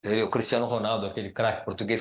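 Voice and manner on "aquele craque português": usual speaking voice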